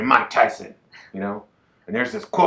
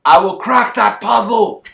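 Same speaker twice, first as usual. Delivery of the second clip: much higher and louder, slow wrestling-promo roar; Drew McIntyre impression; 11 semitones higher, 8 dB louder, slower than usual. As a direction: bellow it like a wrestler cutting a promo. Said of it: "that was Drew McIntyre".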